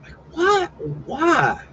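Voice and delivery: high, disbelieving what and why; baffled. Real, not acted. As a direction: react in real confusion. Baffled by odd street layout.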